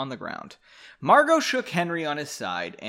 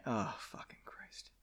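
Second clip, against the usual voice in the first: whispering; 5 semitones lower, 17 dB quieter than usual. Real, not acted. Muttered frustration under breath.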